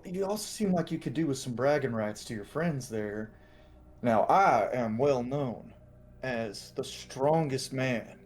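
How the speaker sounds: easygoing swagger, lingering on the boast; Cal. Acted. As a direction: talk yourself up and goad him into a contest. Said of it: "And Cal's going".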